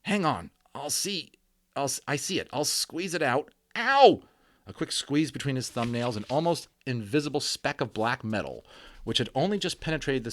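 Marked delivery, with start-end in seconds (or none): 0.0-4.2 fussing friend. Acted. Omri squeezes out the bullet.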